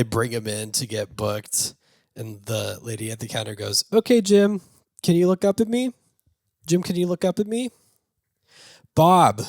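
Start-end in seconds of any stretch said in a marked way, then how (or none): 3.9-9.4 high, loud coaxing clerk voice; booking clerk. Retold or imitated. Counter lady coaxing Bob at booking, high coaxing. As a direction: coax him to look up, then snap his name.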